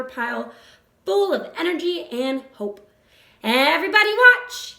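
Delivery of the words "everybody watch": loud, high call for attention; crying out. A narrator's reading. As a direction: shout to the whole barnyard to look. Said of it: "he cried".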